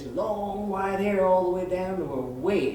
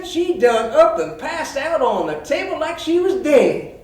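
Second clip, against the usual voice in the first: high, loud country storyteller voice; backwoods cat owner; 8 semitones higher, 9 dB louder than usual. Retold or imitated. Billy Joe explains the shotgun mishap to the vet, worked up. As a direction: imitate an excited hillbilly recounting a mishap. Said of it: "And then he tells me".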